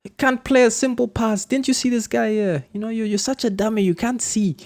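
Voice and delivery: high-pitched mock coach yell, insulting the player; angry coach yelling. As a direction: play the furious coach scolding from the sideline. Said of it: "the coach shouts at you".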